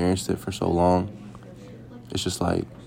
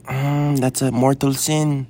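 slow, higher, louder grave grandma; imitating grandma; 7 semitones higher, 5 dB louder, much slower than usual. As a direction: intone mortal sin like a pious Lola. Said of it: "And she was like".

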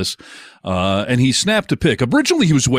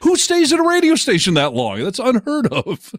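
high mock voice; 14 semitones higher than usual.

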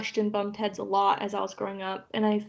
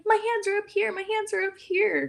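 innocent protest; 11 semitones higher than usual. Retold or imitated. Teasing sister while hiding under blanket.

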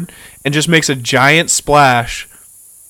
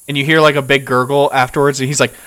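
usual speaking voice

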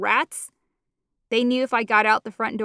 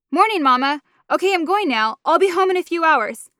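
daughter gabbling her goodbye, higher and louder; rushed, hoping to slip out; 6 semitones higher, 4 dB louder than usual. A narrator's reading. Daughter hurrying to leave before her mother notices. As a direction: get it out fast before Mama can answer. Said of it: "I spoke quickly in desperate hopes".

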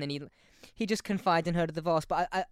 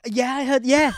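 high, loud burst of approval; egging someone on, raised voice; rowdy cheering; 8 semitones higher, 14 dB louder than usual. Real, not acted. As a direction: whoop it up loudly. Cheering a cheeky film line.

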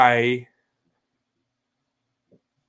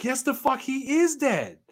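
high, slow, outraged disbelief; incredulous retort; 13 semitones higher, slower than usual. Retold or imitated. Retelling to a friend how he snapped back at Doc, high and slow in disbelief. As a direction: stretch it out in mock outrage, way up high. Retold argument over Val Kilmer.

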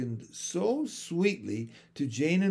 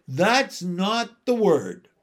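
contemptuous; 5 semitones higher, 7 dB louder, slower than usual. A narrator's reading. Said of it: "said Mary Poppins contemptuously".

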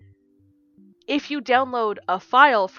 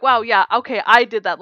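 louder, triumphant; excited; 2 semitones higher, 7 dB louder than usual. Retold or imitated. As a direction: crow over a win. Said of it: "I was so excited".

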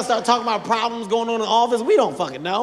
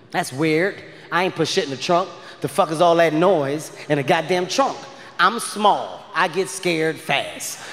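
ordinary delivery

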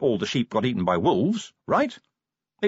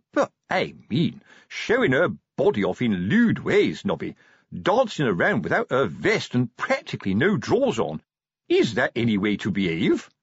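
scandalised moral objection; prudish sergeant; 3 semitones higher than usual. Acted. Sergeant questioning dancer's lewd ways, prudish. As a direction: protest like a prim old sergeant.

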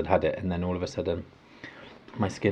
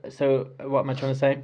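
usual speaking voice

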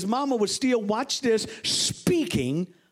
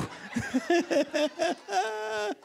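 high, soft real laughter; amused laugh; 7 semitones higher, 4 dB quieter than usual. Real, not acted. Preacher after joking about billy goats, amused laughing. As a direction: laugh at your own joke.

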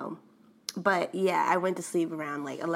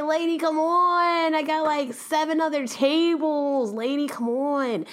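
higher, slow, fed-up whine; exasperated inner plea; 7 semitones higher than usual. Retold or imitated. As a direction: drag it out like you're begging her to hurry. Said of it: "in my head".

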